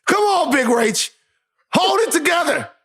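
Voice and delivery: high-pitched pleading call; urgent pep talk. Real, not acted. Co-host tells Rachel to hold it together, urging.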